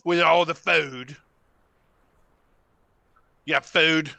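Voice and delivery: loud gruff monster; bugbear voice. Acted. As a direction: growl it like a hungry brute. Bugbear speaking to party.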